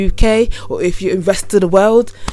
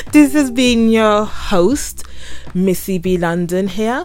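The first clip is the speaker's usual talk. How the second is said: stretched-out, upbeat closing plug; podcast sign-off; 5 semitones higher than usual. Real, not acted. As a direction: sing out your sign-off to listeners. Podcast host signing off to listeners.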